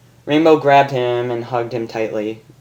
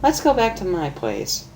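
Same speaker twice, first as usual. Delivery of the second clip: seductive invite; 6 semitones higher, 4 dB quieter than usual. Acted. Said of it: "looking at him seductively".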